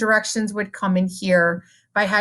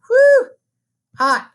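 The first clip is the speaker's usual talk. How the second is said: excited whew; 12 semitones higher, 7 dB louder than usual. Real, not acted. Exclaiming over finishing.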